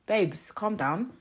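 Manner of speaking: slowly, teasing the eager guy; unimpressed slow drawl of babes calm down; slow sarcastic put-down. Acted. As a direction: tell him to calm down, slowly and dryly. Mocking a too-eager man.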